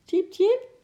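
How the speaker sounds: very high, loud chirp; squeaking little animal. Acted. Little Eki to Sjimp, eager to join in, squeaking. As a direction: squeak like a tiny squirrel. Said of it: "piepte Eki".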